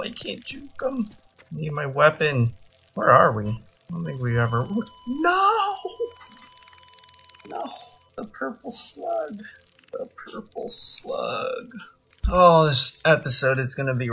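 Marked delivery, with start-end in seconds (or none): 0.0-1.1 high breathless whisper; panicked whisper. Real, not acted. Panicking while chased. 5.1-7.6 very high cry of no; anguished cry. Real, not acted. About to lose slug. 8.2-9.4 high, soft, slow lament; dismayed lament. Real, not acted. Mourning the lost slug. 9.9-11.7 slow breathless whisper of loss; whispered grief. Real, not acted. Mourning the lost slug.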